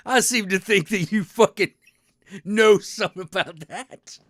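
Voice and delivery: loud, high-pitched ribbing at a friend; loud teasing call. Real, not acted. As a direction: call him out, laughing and teasing.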